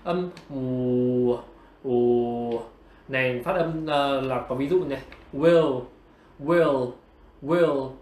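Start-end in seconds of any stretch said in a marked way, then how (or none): 0.0-2.6 low, slow "ù" held long; drawn-out W sound. Shown as a demonstration. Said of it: "chúng ta sẽ có cái". 5.3-7.9 "will" with its final L sounded; clear ending sound. Shown as a demonstration. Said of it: "có ending sound ở cuối".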